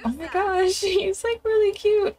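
very high squealing gush; high giddy drunk girl voice. Pretend. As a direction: squeal over a cute boy. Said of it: "she's like".

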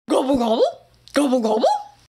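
gobble like a rolling tractor; turkey gobble engine. Shown as a demonstration. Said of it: "driving up down the lane".

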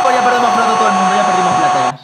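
breakneck goal commentary. Real, not acted. Reacción al gol, gritando rápido.

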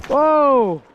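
ordinary delivery

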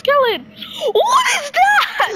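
high shouting while fleeing; panicked yelling. Real, not acted. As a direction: yell in fear.

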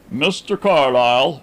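lightly voiced formal announcement; servant's announcement. A narrator's reading. Servant announces a visitor, formal announcement. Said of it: "announced the servant".

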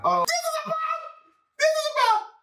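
shrieking with joy at the song; excited shout. Real, not acted. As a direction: yell it out in delight. Album reaction, track drops.

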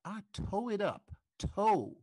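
TOE said as dialect for tore; missing R. Shown as a demonstration. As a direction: drop the R and N, stretch TOE. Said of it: "the R that's missing".

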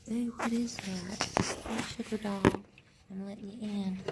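hushed, half-whispered coaxing to a pet; soft pet talk. Real, not acted. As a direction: murmur sweetly to the animal at the door. Whispering to her pet outside.

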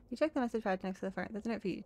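ordinary delivery

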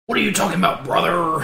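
throaty wrestler bellow; Hulk Hogan. Acted. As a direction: growl it like a hammy pro wrestler. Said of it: "Hulk Hogan".

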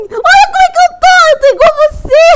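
high, loud excited yelling; excited shout. Real, not acted. Daughter shouting to her mom in the game, thrilled. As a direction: shriek for mom to look.

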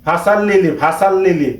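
"ফাসাল lily" with a plain s and "a" in "sal"; common mispronunciation. Retold or imitated. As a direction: run "ফাসাল" together with an open "a". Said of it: "অনেকেই".

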